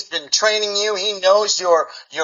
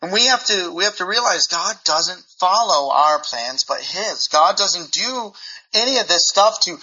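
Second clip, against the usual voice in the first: ordinary talk, nothing marked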